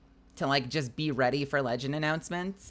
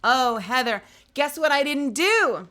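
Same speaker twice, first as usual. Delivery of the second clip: excited outburst; 8 semitones higher, 8 dB louder than usual. Real, not acted. Realizing a mistake mid-signing.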